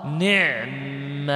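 نعم as ni'-mma, still ayn and long humming doubled m; iskan reading. Shown as a demonstration. Teacher demonstrating iskan, slow chanted tajweed. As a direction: stop on the ayn of نعم, then hold the m. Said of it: "تسكن ثم تشدد".

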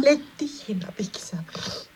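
put-on harsh whispered order to a child; whispered command, slowing at the end; stern whisper. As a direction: hiss it so no one else hears. Mother sends child to bed.

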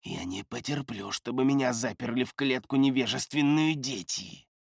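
a haunted blade, coldly indignant; rustling creature voice. Acted. The dismemberer's voice in his head.

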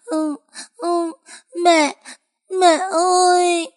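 high, slow, broken wailing; a little girl crying out for mommy; sobbing child. Acted. Child sobbing for her mother.

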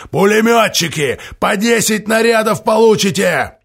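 high barking threat; angry shout. Acted. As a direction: yell the threat across the deck. Captain yelling at gunners.

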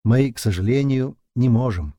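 ordinary delivery

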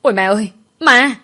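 loud, high cry of alarm; narrator's acted shriek, jumping up on the last word; frightened scream. A narrator's reading. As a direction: yell out as if seeing a ghost. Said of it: "tôi và thư ký gào lên".

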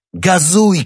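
loud urgent shout. A narrator's reading. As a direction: yell it over the engine. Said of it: "крикнул Лизе Кирилл".

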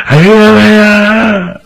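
high, slow wail; acted wailing. Fang Shu'an crying his heart out before the tablets, wailing. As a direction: let out a drawn-out wail of grief. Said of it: "你让我哭一哭".

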